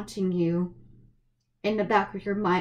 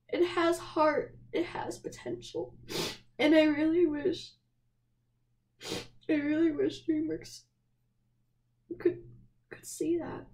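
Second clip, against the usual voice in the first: higher, fading into a teary near-whisper; choked up; 6 semitones higher, 3 dB quieter than usual. Real, not acted. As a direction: voice breaking as tears come.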